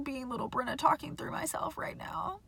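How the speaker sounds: hushed, breathy confession to herself; whispered aside. Real, not acted. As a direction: let it slip out under the breath. Emotional aside about her inner child.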